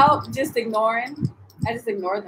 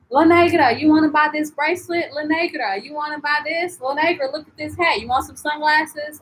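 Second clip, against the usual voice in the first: higher, insistent mimicked sales pitch; pestering vendors; 5 semitones higher than usual. Vendors harassing her at the sunset wall, mimicked pestering. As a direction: badger like a street hawker. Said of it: "people yesterday were harassing me".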